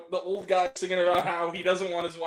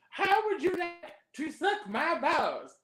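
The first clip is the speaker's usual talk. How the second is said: higher, slow cartoon teacher voice; a hammed-up cartoon school teacher; Mister Garrison voice; 9 semitones higher, slower than usual. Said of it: "Mister Garrison".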